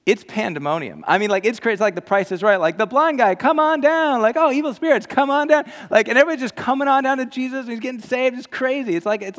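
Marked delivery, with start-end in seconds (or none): 2.8-4.2 loud, high game show announcer call; booming Price is Right host. Retold or imitated. 4.4-5.6 loud, high game show announcer call; booming Price is Right host. Retold or imitated.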